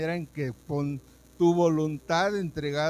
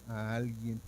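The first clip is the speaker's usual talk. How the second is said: ordinary talk, nothing marked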